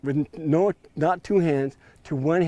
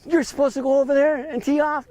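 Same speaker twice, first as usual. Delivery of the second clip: much higher and louder; retold yelling; 12 semitones higher, 5 dB louder than usual. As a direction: yell the instruction impatiently. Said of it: "do you have to yell at me".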